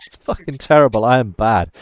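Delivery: ordinary talk, nothing marked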